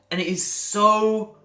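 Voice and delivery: loud, high, drawn-out frustration; exasperated. Acted. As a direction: stretch it out at the top of your voice. Frustration carries on after the rant.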